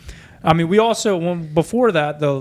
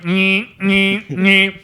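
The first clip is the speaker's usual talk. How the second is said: machine noise; 5 semitones higher, 4 dB louder, much slower than usual. Retold or imitated. Imitating grinding machine.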